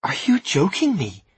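slow, high, loud incredulous question; disbelief. A narrator's reading. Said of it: "She stared at him in disbelief".

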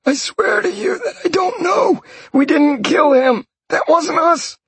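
high pleading voice of a beaten man; terrified captive. Acted. Captured mage swearing to Sean he killed nobody, high and pleading. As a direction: beg him in a high panicked voice.

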